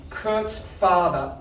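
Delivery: usual speaking voice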